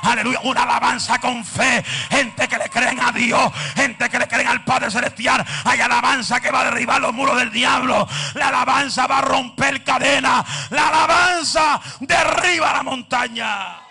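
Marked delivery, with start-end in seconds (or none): 3.1-13.8 loud rising preacher's shout; preaching at full force. Acted. Preacher proclaiming praise tears down mountains, loud and building. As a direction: build to a thundering climax.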